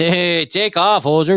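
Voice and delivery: put-on slow hoser Canadian accent. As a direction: drawl it like a Canadian hockey bro.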